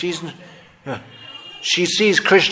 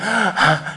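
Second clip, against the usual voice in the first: sobbing gasp; 3 semitones higher, 3 dB quieter than usual. Shown as a demonstration. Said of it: "I'm enacting it dramatically".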